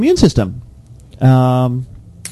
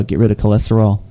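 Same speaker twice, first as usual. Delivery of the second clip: usual speaking voice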